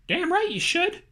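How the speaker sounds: a mild bark of agreement, fist on the desk; booming. A narrator's reading. Boss to Andy after slamming the desk, mildly booming. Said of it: "he boomed again".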